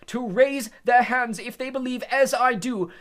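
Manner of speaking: loud, high, slow shout. Acted. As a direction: shout over the room to the jury. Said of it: "shouted Mister Crouch".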